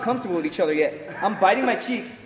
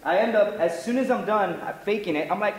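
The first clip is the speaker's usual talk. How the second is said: ordinary delivery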